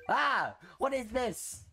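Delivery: high-pitched yelp of disbelief; dismayed outcry. Real, not acted. Player facing a no continue stage, dismayed outcry. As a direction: cry out in protest at the game.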